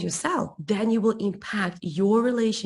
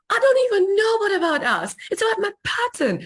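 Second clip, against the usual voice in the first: higher, faster, louder excited burst; excited high rush; 11 semitones higher, 5 dB louder, faster than usual. Retold or imitated. Telling her husband she is breaking her pattern, excited and rushed. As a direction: blurt it out giddy with discovery.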